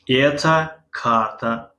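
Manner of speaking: slow, careful Russian reading; slow teacher demo; slow model reading. Shown as a demonstration. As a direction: read it out slowly for the class. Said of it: "این خریطه است".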